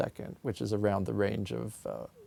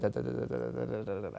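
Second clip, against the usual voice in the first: quick soft "da, da" pushed as fast as the tongue allows; sped-up pulse; 6 dB quieter, much faster than usual. Shown as a demonstration. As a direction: rattle the "da" faster and faster. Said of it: "But if I could speed that".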